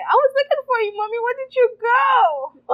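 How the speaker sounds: high, loud imitation of a child; complaining child. Child asks mother where she went, high mock complaint. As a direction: play the kid demanding to know where Mommy went. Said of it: "they told me this morning".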